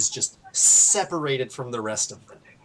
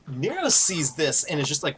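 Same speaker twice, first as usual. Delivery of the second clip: ordinary delivery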